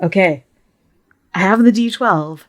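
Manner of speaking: usual speaking voice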